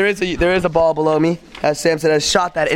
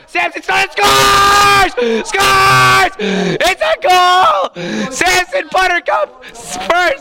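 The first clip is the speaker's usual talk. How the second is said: high shouted goal call; 13 semitones higher, 5 dB louder, much slower than usual. Acted. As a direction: yell it like the goal just went in.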